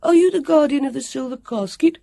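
put-on high, loud child's question; excited boy Sam. Sam addressing the silvery woman, bold boy voice. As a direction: ask boldly in a boy's voice.

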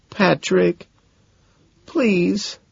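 high, thin pleading; acted tearful plea; begging. A narrator's reading. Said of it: "she begs".

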